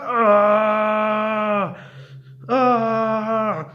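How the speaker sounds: high, loud drawn-out "aah"; scream from heat. A narrator's reading. Witch behind shield in dragon fire, pained scream. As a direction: cry out as the fire gets too hot.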